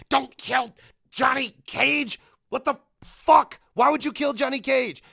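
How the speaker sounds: loud, high fan rant, hitting each word hard; worked-up shouting at the movie; exasperated outrage. Real, not acted. Ranting over Johnny Cage's death.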